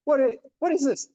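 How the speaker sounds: retold outraged what-is-this; high incredulous exclamation; indignant surprise. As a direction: pitch jumps high in disbelief. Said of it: "So I'm like".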